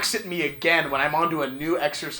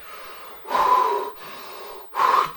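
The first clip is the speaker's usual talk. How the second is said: heavy breathing. Retold or imitated. Imitating the terrifying man at the gym, heavy breathing. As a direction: imitate someone breathing heavily. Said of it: "breathing in and out really heavily".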